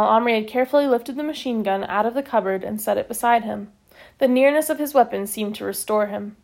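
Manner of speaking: usual speaking voice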